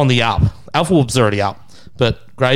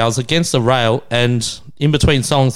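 normal talking voice

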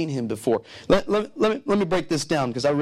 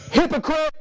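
mock accusation; 10 semitones higher, 3 dB louder than usual. Glaring at wife during worship.

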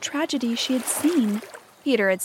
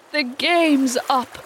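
slow, raised, impassioned declaration; passionate cry; 4 semitones higher, 4 dB louder, slower than usual. A narrator's reading. Hook announcing defeat to his pirates, passionate. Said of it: "he spoke passionately".

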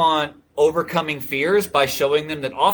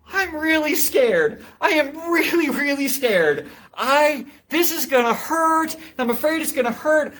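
put-on scared little kid; 11 semitones higher than usual. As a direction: voice the frightened boy begging not to spar. Scared kid before sparring.

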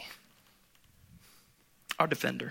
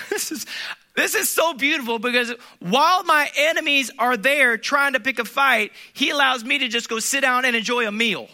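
preaching at full force; 8 semitones higher, 7 dB louder than usual. Acted. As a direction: press the point home to the congregation.

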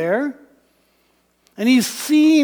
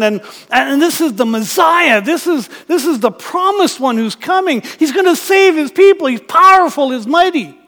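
higher and louder, building to a thrilled peak; growing excitement; 4 semitones higher, 6 dB louder than usual. Acted. Nicodemus realizing the Messiah, rising excited and louder. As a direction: piece it together faster and faster, thrilled. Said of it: "Now Nicodemus is going".

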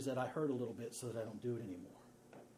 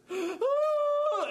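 very high loud crying gasp; sobbing gasp; 10 dB louder than usual. Retold or imitated. Emily crying down the stairs: imitated wail. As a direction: wail like a hurt toddler.

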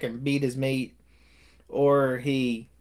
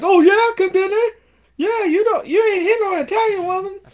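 very high, loud, fast squeaky fury; mock Rocky outrage; 6 dB louder than usual. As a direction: squeal it like an offended husband. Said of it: "And Rocky's like".

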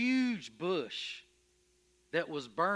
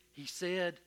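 ordinary delivery

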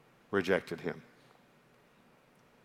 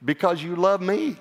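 lightly voiced wife, pitched up high; a hint of the wife's higher voice; imitated wife; 8 semitones higher, 3 dB louder than usual. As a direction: offer it as a tentative, high-pitched answer. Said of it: "She said".

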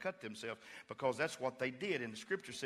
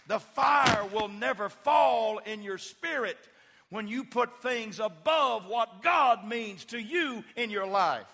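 full-force preaching; 9 semitones higher, 11 dB louder than usual. Acted. Pastor crying out to his congregation about false gods. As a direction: drive it home to the congregation.

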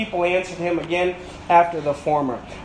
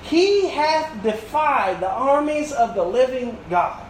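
scripture reading; 5 semitones higher than usual. Acted. Preacher reads David's speech.

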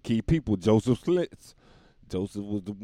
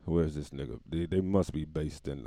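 ordinary talk

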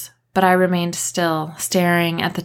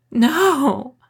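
higher anguished wail; 8 semitones higher than usual. A narrator's reading. Lex wailing no while rocking in terror, higher. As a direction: cry it out in despair. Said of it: "I wailed".

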